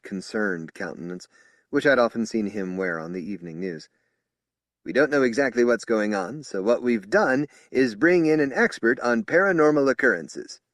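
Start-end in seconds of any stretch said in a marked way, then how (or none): 4.8-10.6 higher and louder official tone; strident spokesman. Acted. Spokesman explaining the psychic to officers, loud. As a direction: announce it to the room.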